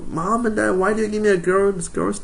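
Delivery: put-on high, whiny little boy asking his parents; a son pleading with his parents in a high child's voice; little kid voice. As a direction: squeak it like a four-year-old. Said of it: "I asked them".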